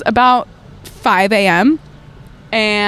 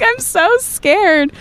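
mock scared; 10 semitones higher, much slower than usual. Mock fear, slow high.

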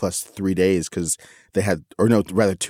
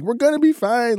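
mock shout back. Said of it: "screaming argument".

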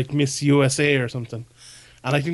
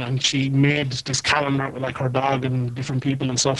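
choppy robotic sound on a bad line; glitchy cyborg-like audio. Real, not acted. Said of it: "[name], you sound like a cyborg".

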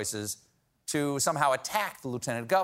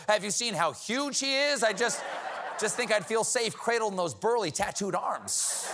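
higher, dreamy mock swoon; swooning fan; 5 semitones higher than usual. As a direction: gush like a smitten fan. Mock swooning over Fetterman.

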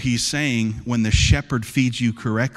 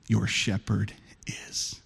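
hushed aside; 3 semitones lower, 7 dB quieter, much slower than usual. Acted. Sermon point dropped to hush.

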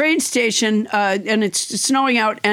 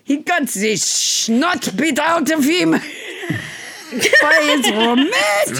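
slow, higher, hammed-up German accent; 6 semitones higher, slower than usual. Shown as a demonstration. Host recounting the book in a mock German accent. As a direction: ham up a German accent, slowly. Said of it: "It really should be done with a German accent".

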